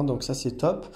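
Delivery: ordinary delivery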